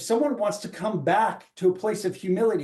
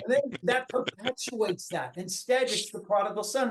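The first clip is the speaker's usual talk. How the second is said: ordinary talk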